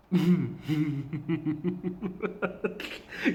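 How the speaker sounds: long helpless giggling fit; uncontrollable laughter. Real, not acted. Vlogger cracking up over the commercial mid-sentence, laughing helplessly. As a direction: break into laughter you cannot stop. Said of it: "I can't stop laughing".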